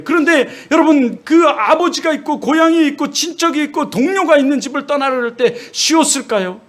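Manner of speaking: high, impassioned sermon voice; fervent preaching. Acted. Preacher urging the congregation, impassioned and high. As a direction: preach it, rising with conviction.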